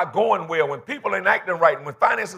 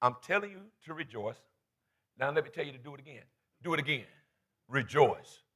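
low, soft and deliberate; quietly firm instruction; hushed insistence; 4 semitones lower, 10 dB quieter than usual. Acted. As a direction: drop right down to press the point. Quotes writer insisting, hushed for emphasis.

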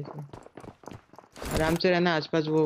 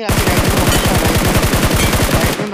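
loud urgent warning; urgent callout; 9 dB louder, slower than usual. Real, not acted. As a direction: shout the enemy's position. Urgent enemy callout to teammate.

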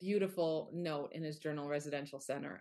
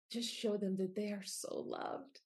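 ordinary delivery

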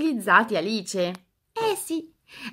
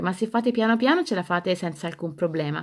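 normal talking voice

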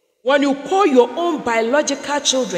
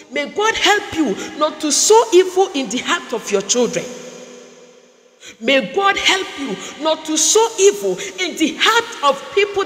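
preaching; 2 semitones higher than usual. Acted. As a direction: press the blessing on the crowd. Preaching blessing at full force.

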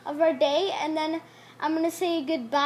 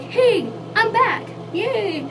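excited greeting; 8 semitones higher, 3 dB louder, much slower than usual. Retold or imitated. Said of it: "Because I'll say, like".

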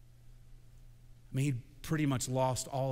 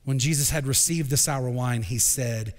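ordinary talk

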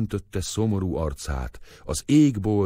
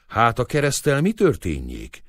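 plain reading voice

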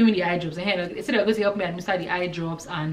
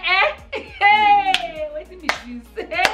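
baffled exclamation; 12 semitones higher, 4 dB louder, much slower than usual. Retold or imitated. Baffled reaction in Pidgin.

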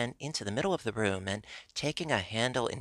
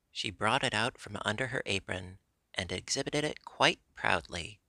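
ordinary delivery, nothing marked

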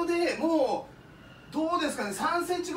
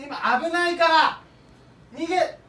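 raised voice over the storm wind; shouting over wind; 2 semitones higher, 7 dB louder, slower than usual. Pretend. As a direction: shout the report into the gale. Typhoon field reporter in wind. (風に負けず叫ぶ)

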